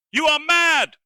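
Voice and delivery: very high, loud squall; furious squall. Acted. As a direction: squall it at him. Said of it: "squalled Sota".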